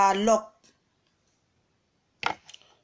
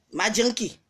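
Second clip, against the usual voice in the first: ordinary delivery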